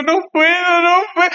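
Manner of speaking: high-pitched comic plea of dread; mock panic. Scared pastor admitting defeat to everyone, high mock panic. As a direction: beg off in an exaggerated high panic. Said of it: "yo estaba con un temor".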